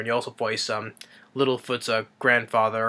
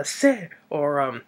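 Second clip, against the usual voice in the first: charades hints; 5 semitones higher, 4 dB louder, much slower than usual. Retold or imitated. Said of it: "three syllables. Sounds like".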